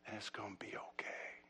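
soft whispered reassurance; whispering that it will be okay. Acted. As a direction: whisper it gently, barely voiced. Comforting hush at sermon's climax.

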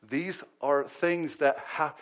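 ordinary talk, nothing marked

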